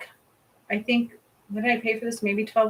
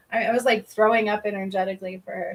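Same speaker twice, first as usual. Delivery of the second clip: ordinary delivery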